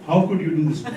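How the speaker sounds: low, soft mock-hurt question; quiet reproach. Husband turning her question back on her, quiet reproach. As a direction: deliver the accusation softly and low.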